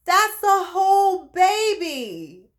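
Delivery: high, drawn-out wonder; amazed. Retold or imitated. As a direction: marvel slowly, stretching each word in astonishment. Amazed at first sight of baby.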